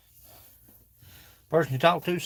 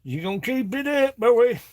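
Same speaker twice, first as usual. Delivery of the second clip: higher put-on warning voice; mock scolding; 8 semitones higher, 5 dB louder than usual. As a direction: tease the figure like a cranky old-timer. Teases figure.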